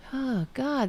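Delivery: ordinary talk, nothing marked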